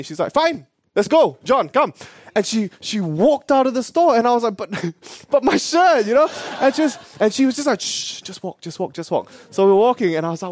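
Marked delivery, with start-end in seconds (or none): 0.3-1.9 high, drawn-out imitation of a huffy auntie; mock-offended summons; bluffing walkout. Aunt walks out on vendor. 4.6-6.1 high, pleading little objection; mock plaintive, with a laugh; childish protest. Child protests leaving the shirt. 7.8-9.3 hushed, near-whispered urging; soft, conspiratorial repeated order; secretive hushing. Retold or imitated. Aunt hushes child while walking away.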